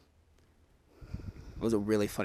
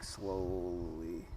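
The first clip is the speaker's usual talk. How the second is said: slow, softer, lingering delivery; drawn-out slowly; 3 semitones lower, 8 dB quieter than usual. Real, not acted. Telling of a van creeping by behind them, drawn out slowly. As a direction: stretch the word out very long.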